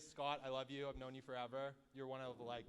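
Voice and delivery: ordinary talk, nothing marked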